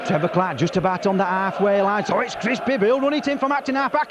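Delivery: excited rugby commentary, rising; live play-by-play. Real, not acted. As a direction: call the match live.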